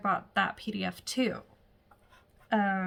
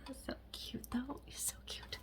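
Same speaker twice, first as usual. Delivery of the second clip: whispered aside; 3 semitones higher, 16 dB quieter than usual. Real, not acted. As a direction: breathe it under your voice. Aside to the baby.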